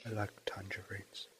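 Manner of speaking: breathy, hushed deadpan; mock whisper. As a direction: whisper it as an inside joke. Running gag whispered.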